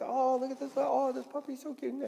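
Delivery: high mock baby talk; gushing over a puppy. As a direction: swoon over a cute puppy.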